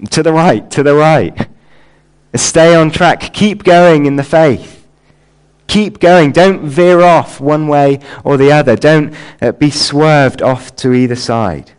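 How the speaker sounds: loud, deliberate exhortation, stretched key words; calling out. Acted. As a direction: call out emphatic warnings to keep someone on course. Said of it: "Paul calling out to Timothy".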